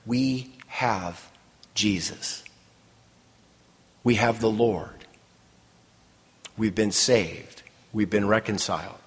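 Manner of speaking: low, soft, reverent; spaced-out phrases, gently assured; quiet reassurance. Real, not acted. Preacher's hushed, reverent assurance.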